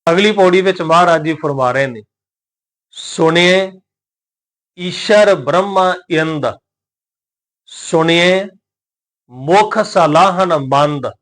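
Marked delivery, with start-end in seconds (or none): 2.9-11.1 slow, measured recitation of sacred verse; scripture recitation. Shown as a demonstration. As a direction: recite each word drawn out, with pauses.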